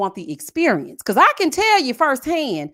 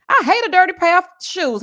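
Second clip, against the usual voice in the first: higher and louder outburst; exclaiming; 7 semitones higher, 6 dB louder than usual. Real, not acted. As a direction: burst out in disgust. Outburst about dirty shoes.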